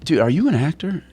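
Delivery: high-pitched, probing question; intense. Retold or imitated. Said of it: "really, you know, intense".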